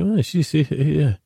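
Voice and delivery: lightly voiced, high doubtful listener; high mock shrug. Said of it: "You kinda say".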